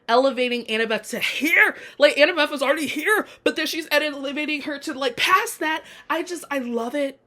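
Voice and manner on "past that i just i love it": usual speaking voice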